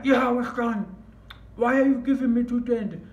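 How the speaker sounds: retold outraged shout, high and loud; going crazy. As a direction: demand an explanation at full voice, high-pitched. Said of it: "I'm going crazy now".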